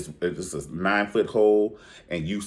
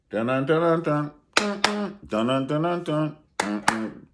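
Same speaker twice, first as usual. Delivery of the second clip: higher mock theme-song chant; sing-song Green Acres hum; humming a tune; 8 semitones higher than usual. Real, not acted. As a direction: dun it out like a show theme. Mimicking a tune.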